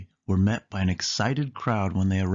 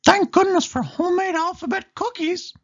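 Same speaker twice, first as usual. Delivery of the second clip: much higher, louder mouse voice; Mickey voice; 5 dB louder than usual. Acted. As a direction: say it gratefully as Mickey. Mickey adds cookies.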